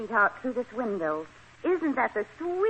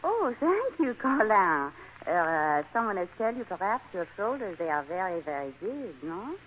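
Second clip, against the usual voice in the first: coquettish put-on French accent; phony French accent; 3 semitones lower, 4 dB quieter than usual. French guest flirts with Colin about his shoulders, phony French accent. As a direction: flatter him in a fake French accent, trailing softer. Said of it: "My phony French accent".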